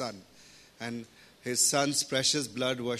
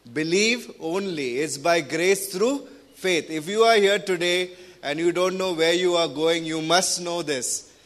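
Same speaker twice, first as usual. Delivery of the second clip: louder, rising preacher's appeal; fervent preaching; 5 semitones higher, 5 dB louder than usual. Acted. Preacher urging listeners who doubt their salvation, loud and fervent. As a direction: press the truth home to the congregation.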